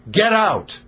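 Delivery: sharp high order; angry. Retold or imitated. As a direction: snap it out harshly. Host refusing, harsh.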